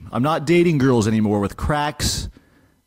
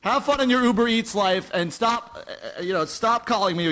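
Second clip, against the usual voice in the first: angry; 8 semitones higher than usual. Acted. Breaking up with girlfriend on air.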